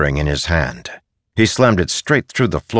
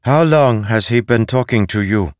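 higher-pitched, firm questioning; authoritative; 6 semitones higher, 4 dB louder than usual. Acted. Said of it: "spoke with authority".

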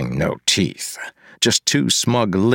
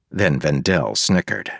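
ordinary delivery, nothing marked

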